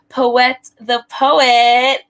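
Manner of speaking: drawn-out hype introduction of the guest; grand introduction. Real, not acted. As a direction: present the guest like a headliner. Host announces guest with drawn-out flourish.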